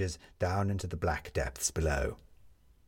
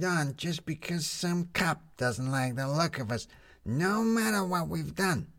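Lizzie's voice; 8 semitones higher, slower than usual. A narrator's reading.

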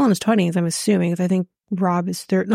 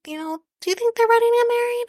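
much higher, fast and mocking, imitating Claire's fake concern; faster than usual. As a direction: squeak it out like a nosy guest. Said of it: "she's basically saying".

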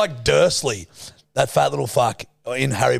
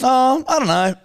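mock high voice; 10 semitones higher, 4 dB louder than usual. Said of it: "and you were like".

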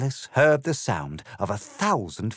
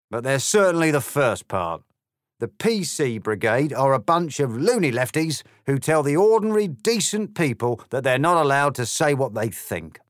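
high, louder, cocky grinning cat; smug cat; 6 semitones higher, 6 dB louder than usual. Acted. The grinning cat answering Alice with a swagger. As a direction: toss it off like a swaggering pub cat.

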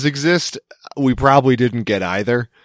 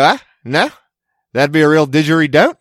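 higher, sing-song put-on Aussie pun delivery; mock Aussie accent; 5 semitones higher, 3 dB louder than usual. Host parodying Australians dodging blame, high mock accent. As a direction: deflect breezily in a hammed-up Aussie accent. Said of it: "And we go".

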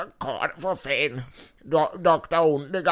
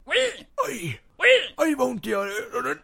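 mock pain; 10 semitones higher, slower than usual. Villains hurt by sound.

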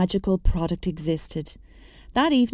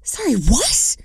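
breathless whispered exclamation, pitched up; a stunned, airy exclaim; 5 semitones higher, 3 dB louder than usual. Acted. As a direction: gasp the question out in shock. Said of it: "I exclaimed".

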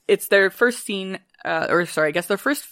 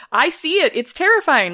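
high, louder hammed-up fear; mock fright; 9 semitones higher, 4 dB louder than usual. Macbeth insisting to guests he sees the ghost, mock fright. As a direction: insist shakily you see it. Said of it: "And he's like".

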